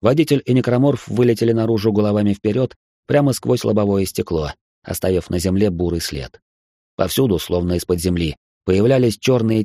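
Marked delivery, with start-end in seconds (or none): none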